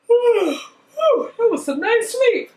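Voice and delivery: high laughing whew; laughing refreshed servant. Acted. Servant waking after a good sleep, high and laughing. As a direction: laugh through it, stretched and rested.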